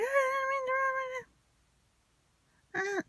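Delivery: very high-pitched mock plea; imitated wailing apology. She apologises for the ruined nap, imitated very high. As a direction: squeal the apology, imitating someone upset.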